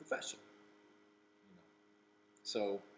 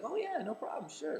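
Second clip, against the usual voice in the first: high, light easygoing voice; breezy celebrity; 4 dB louder than usual. Retold or imitated. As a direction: toss it off like it's nothing. Imitating Charlie's breezy answer.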